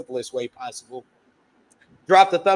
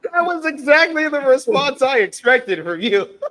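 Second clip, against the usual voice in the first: talked through real laughter, higher and louder; laughing; 10 semitones higher, 7 dB louder than usual. Real, not acted. Host laughing at his cohost's blunt no. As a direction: laugh the line out.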